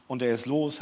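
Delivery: usual speaking voice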